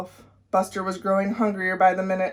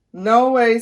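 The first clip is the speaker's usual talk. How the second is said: loud refusal; 2 semitones higher, 7 dB louder than usual. A narrator's reading. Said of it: "scrunching his face".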